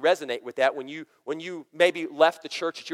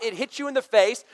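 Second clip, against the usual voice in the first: ordinary talk, nothing marked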